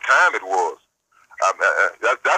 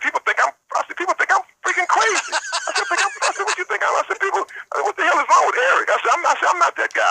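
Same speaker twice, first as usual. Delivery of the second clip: pitched way up, getting heated; worked-up retelling; 14 semitones higher than usual. Retold or imitated. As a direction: climb high, indignant and fired up. Retelling what people say about him.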